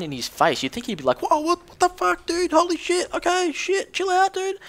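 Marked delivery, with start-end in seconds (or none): 1.2-4.6 very high panicked mock yelp; squealing mock panic at the gun; freaking out. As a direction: play the scared guy losing it. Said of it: "You'd think he'd freak out a bit more".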